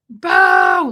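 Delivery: loud, high drawn-out holler; yelled call; imitated scream. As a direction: belt out a call, short of a real scream. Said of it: "have to really scream it".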